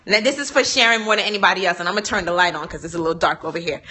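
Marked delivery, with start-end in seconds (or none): none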